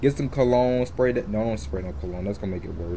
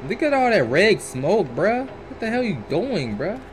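higher, startled reaction; higher alarmed cry; alarmed outburst; 7 semitones higher than usual. Real, not acted. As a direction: react in disbelief to the smoke. Reacting to the red smoke.

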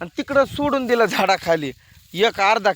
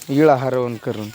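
normal talking voice

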